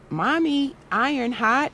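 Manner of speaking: put-on high child's voice asking a question; a small boy checking with mommy; high childlike query rising on hot; little son asking. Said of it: "he was like".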